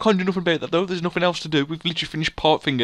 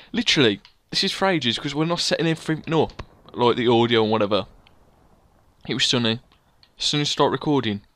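ordinary talk, nothing marked

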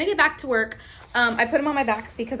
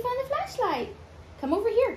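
sing-song mom voice; 11 semitones higher than usual. Real, not acted. Talking to her child.

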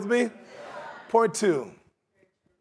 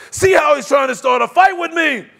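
loud, high indignant shout; worked-up angry king; 5 semitones higher, 8 dB louder than usual. Acted. Preacher acting the king of Israel, angry shout. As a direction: shout it like an offended ruler. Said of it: "so worked up and so angry".

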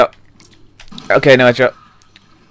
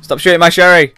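high, raised protest; indignant call, pitched up; protective shout; 6 semitones higher, 4 dB louder than usual. Real, not acted. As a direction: yell at enemies to lay off. Shouting at game enemies.

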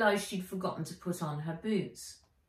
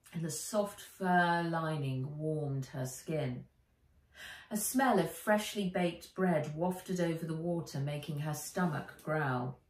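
plain reading voice

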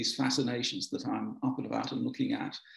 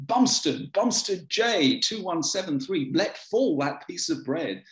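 higher, commanding telescreen bark, slowing at the end; accusatory; 4 semitones higher, slower than usual. Acted. Telescreen catches a prisoner passing bread, accusatory. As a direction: read out an accusing order to a prisoner. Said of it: "bursts out with an accusatory".

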